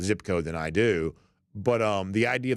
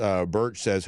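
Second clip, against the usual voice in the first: normal talking voice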